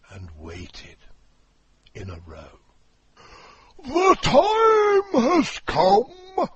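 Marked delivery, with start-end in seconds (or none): 3.8-6.5 very high, loud, slow walrus proclamation; walrus proclaiming. Acted. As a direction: announce it grandly, stretching each word. Walrus opening speech.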